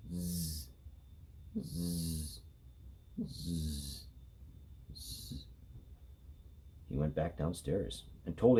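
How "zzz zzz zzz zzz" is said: soft low snores fading to breath; snoring. A narrator's reading. Sleeping girl snoring, fading to a breathy snore. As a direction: snore quietly, last one just air. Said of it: "Amy snored".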